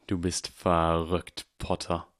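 slow cold sneer; cold contempt. A narrator's reading. Snape sneers at Harry with cold contempt. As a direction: let the contempt drip. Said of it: "mit kalter Verachtung".

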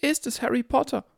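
put-on very high, sing-song old lady; trilling old woman. A narrator's reading. Old woman eager to see Harry, high trilling. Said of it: "trillerte eine alte Frau".

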